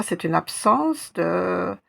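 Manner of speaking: normal talking voice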